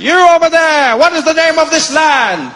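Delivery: high, loud hail from a ship; loud, high demanding hail; shouting colonizer. Acted. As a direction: call across to the shore.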